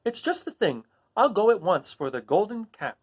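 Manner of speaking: put-on high, eager girl voice; a child rushing off happily; joyful Dorothy. Dorothy going for the golden cap. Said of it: "said Dorothy joyfully".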